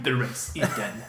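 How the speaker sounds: low slow menacing voice; evil emperor voice. Pretend. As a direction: intone it darkly. Emperor voice joke.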